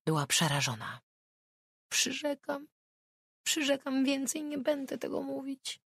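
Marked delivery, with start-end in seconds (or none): 1.9-5.8 put-on frightened child.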